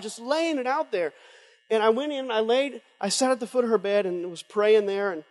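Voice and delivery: ordinary delivery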